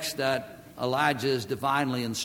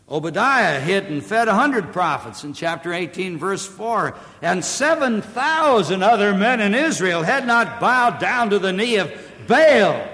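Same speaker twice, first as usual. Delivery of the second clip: high, loud pulpit voice; full-force preaching; 5 semitones higher, 7 dB louder than usual. Real, not acted. Preacher urging the congregation toward repentance, full force. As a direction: preach it out, driving hard.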